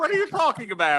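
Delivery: fast high mocking voice; squeaky rushed parody of him; mocking quote.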